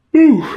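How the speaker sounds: high, loud relieved whoop; loud whew. Real, not acted. Creator whoops after reading out the fight's end. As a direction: let out a big whew.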